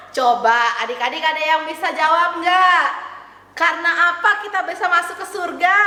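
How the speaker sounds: ordinary talk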